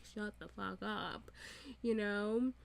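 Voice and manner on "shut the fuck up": muttered under breath. Retold or imitated. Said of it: "rolling my eyes and huffing".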